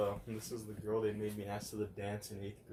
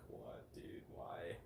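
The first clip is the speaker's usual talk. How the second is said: hushed puzzled whisper; 4 semitones lower, 10 dB quieter, much slower than usual. Retold or imitated. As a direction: whisper it, confused. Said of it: "I was like".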